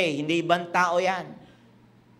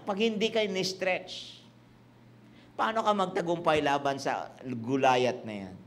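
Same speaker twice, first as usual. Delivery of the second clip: ordinary talk, nothing marked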